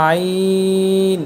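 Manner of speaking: আইন drawn out from mid-throat; Arabic letter demo. Shown as a demonstration. Teacher demonstrating আইন slowly. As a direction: hold the throat sound of আইন long. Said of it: "এর পরের হরফটি".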